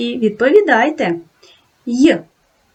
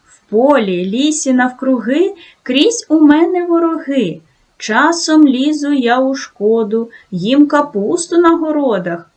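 higher, lively sing-song rhyme; riddle recital; 5 semitones higher than usual. Acted. Teacher to children, riddle in playful recitation. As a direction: tease the answer out of the kids.

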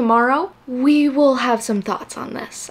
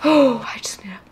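gasp, then breathy words; overwhelmed, catching her breath; faster than usual. Real, not acted. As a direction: gasp and steady yourself. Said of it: "take a breather".

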